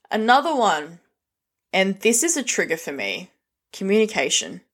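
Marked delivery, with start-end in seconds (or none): none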